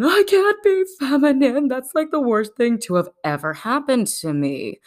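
high, hammed-up wail of dismay; mock horror. Man told he is feminine, mock horrified. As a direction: wail it like a melodrama victim.

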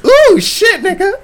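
high-pitched startled yelp, acted out; mock alarm. Comic acting out a bitten wrestler, mock alarm.

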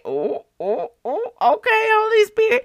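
mock-chastened oh's climbing high; sheepish acceptance. Replying to the Holy Spirit's scolding, high mock sheepishness. Acts out own reply to rebuke.

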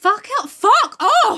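high, loud telling-off; mock-furious dismissal; indignant outburst. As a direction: blurt it in exasperation. Angry outburst at Miss Murdstone.

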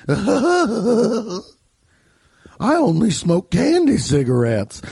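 high, hammed-up ghostly warble with wailed oohs; weird customer voice. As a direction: wail like a goofy old prospector. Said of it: "that guy's fucking voice ruled".

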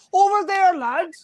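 put-on high sing-song Cork accent, loud; mock Cork accent. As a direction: imitate a Cork local barking directions. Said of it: "they were like".

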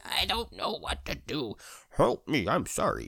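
high, hammed-up helpless machine voice; panicking computer. Glitching computer crying to its owner, hammed-up helplessness. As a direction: play a computer wailing for help. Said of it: "my computer was being really, really weird".

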